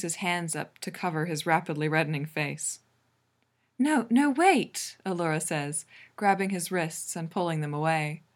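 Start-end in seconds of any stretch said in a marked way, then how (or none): 3.8-5.0 higher, louder urgent plea to wait; urgent protest. A narrator's reading. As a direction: stop them with a high, rising-energy protest. Urgent protest to embarrassed partner.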